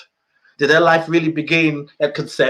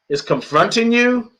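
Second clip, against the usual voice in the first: ordinary talk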